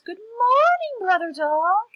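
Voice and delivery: high, loud, drawn-out sugary greeting; sweetest voice. Acted. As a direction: sing out the greeting as sweetly as possible. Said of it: "in his sweetest voice".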